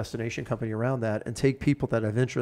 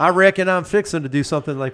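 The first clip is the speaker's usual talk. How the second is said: mock Southern accent; 4 semitones higher, 7 dB louder than usual. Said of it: "very Southern".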